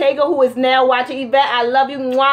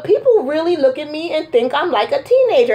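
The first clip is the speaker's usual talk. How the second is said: usual speaking voice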